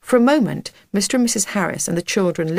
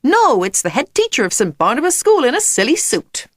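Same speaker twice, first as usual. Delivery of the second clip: high, swooping American announcer; American accent; 6 semitones higher, 4 dB louder than usual. A narrator's reading. Jack mocking the headteacher's silly suit, American accent. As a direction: proclaim it in hammed-up American. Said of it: "in his favourite American accent".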